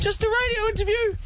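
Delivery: very high, timid squeak; squeaky nervous voice. Retold or imitated. Host calling a film star's hotel room, high nervous squeak. Retold timid reply to angry actor.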